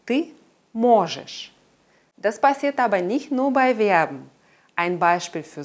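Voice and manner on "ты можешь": "ты можешь" with a long clear O in "мо"; stress on the stem. Shown as a demonstration. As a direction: hit MO in "можешь", weaken the ending. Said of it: "mal auf dem Wortstamm".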